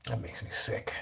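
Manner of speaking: disgusted whisper. Real, not acted. Whispering while filming the sick kitten.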